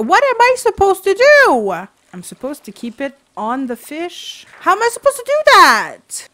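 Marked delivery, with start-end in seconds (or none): 0.0-1.7 high, loud wail of exasperation; frustrated yelling. Real, not acted. Frustrated by fishing minigame. 4.6-6.3 high, loud cry of disbelief; exasperated yelling. Real, not acted. Frustrated by fishing minigame.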